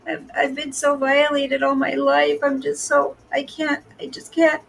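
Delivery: high, slow, whiny victim voice; mocking a friend as a fragile victim, high and whiny; mocking impression. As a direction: play the helpless, frightened victim for laughs. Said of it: "That was a pretty good impression".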